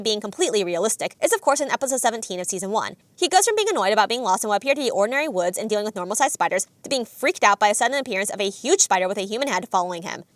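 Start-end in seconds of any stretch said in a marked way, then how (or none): none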